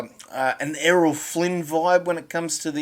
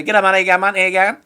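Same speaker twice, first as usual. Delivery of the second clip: quick, louder city Aussie greeting; fast city Aussie; 5 semitones higher, 9 dB louder, much faster than usual. Shown as a demonstration. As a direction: rush it like a busy Brisbane local. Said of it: "they talk fast".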